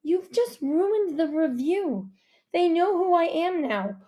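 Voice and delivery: high, slow, dismayed scolding; upset reproach. A narrator's reading. Mom blaming dad for ruining the review, upset. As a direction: draw out the accusation. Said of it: "My mom wasn't".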